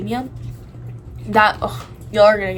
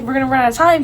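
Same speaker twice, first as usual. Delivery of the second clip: mildly panicked, higher and louder; retold freaking out; 4 semitones higher, 6 dB louder, faster than usual. Retelling the math project rush, mildly freaking out. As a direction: fret aloud, a touch frantic. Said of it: "I was freaking out".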